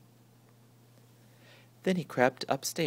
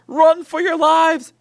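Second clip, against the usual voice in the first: high, loud slow shriek; panicked cousin, very high and loud; 17 dB louder, slower than usual. Acted. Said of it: "shrieked Cousin Elwood".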